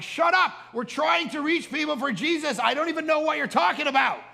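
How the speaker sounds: high, loud comic rant; hammed-up fed-up yelling; exasperated outburst. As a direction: snap back at nitpickers at the top of your voice. Exasperated retort to church people.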